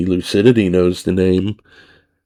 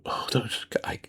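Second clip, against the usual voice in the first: breathy whispered plea; hushed whisper; 15 dB quieter than usual. Retold or imitated. Woman whispering her plea at the door. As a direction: whisper it like a secret.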